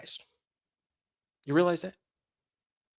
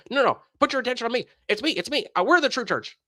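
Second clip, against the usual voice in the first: fast, higher, pushy mock pleading; mock sects' pitch; 7 semitones higher, faster than usual. Rival churches to the seeker, fast and pushy. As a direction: rattle it off like an eager recruiter. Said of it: "They all say".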